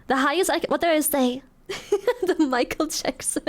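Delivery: normal talking voice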